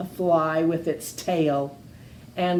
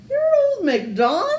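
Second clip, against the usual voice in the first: disbelief; 13 semitones higher, 3 dB louder, much faster than usual. Acted. Witch astonished at farmer.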